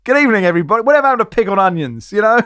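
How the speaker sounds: high, bright showman's greeting to the crowd; jokey ref announcer. Pretend. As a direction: ham it up like a referee playing for laughs. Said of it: "to play it for laughs? ... to go".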